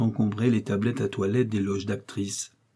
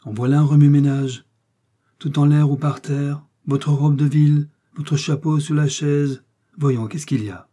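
put-on loud, raised dismay from the old dresser; loud outcry; 4 semitones higher, 6 dB louder than usual. A narrator's reading. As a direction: cry out in shock at the mess. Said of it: "poussa de hauts cris".

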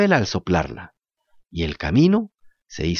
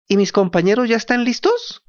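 lightly raised puppet voice; a hint of the wooden puppet; 8 semitones higher than usual. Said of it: "le preguntó la marioneta". (voz ligera de marioneta)

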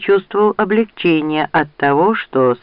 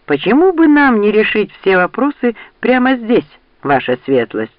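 higher, pointed challenge, dropping at the end; challenging; 7 semitones higher, 3 dB louder than usual. A narrator's reading. Rand challenges the duke to settle it here, challenging. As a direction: throw down a challenge with mock courtesy.

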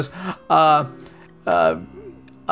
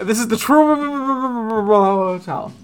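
very slow, higher, endlessly stuttered surname; stroke-like garble; 4 semitones higher, much slower than usual. Pretend. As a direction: stretch Trump into a blubbering echo before Hotel. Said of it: "Did he have a stroke?".

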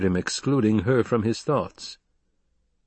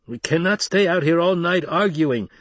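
high, impatient raised voice; challenger from above; 9 semitones higher, 4 dB louder than usual. Acted. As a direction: press the leader to move on. Challenger argues from the rocks.

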